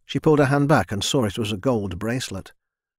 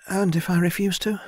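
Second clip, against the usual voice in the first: put-on higher, timid young woman's voice; young servant girl; 9 semitones higher than usual. A narrator's reading. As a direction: voice a shy girl speaking up to her lord. Narrator voices Mina.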